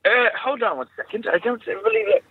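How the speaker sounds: distracted posh British aside; posh British accent. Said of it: "my really posh British accent".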